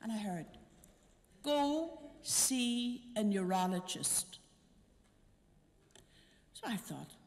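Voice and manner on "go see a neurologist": slow, deliberate voice from the monstrance; clear otherworldly voice. Retold or imitated. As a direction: spell out the instruction slowly and clearly, like a divine message. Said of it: "a voice spoke to me from the monstrance, clear".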